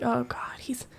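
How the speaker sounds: unvoiced whisper to herself at night. Wife whispering to herself as her husband sits up in bed. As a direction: whisper it so the husband won't wake. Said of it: "and I'm like".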